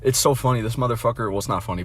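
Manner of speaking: ordinary delivery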